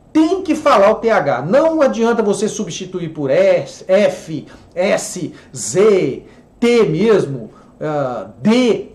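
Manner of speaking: ordinary talk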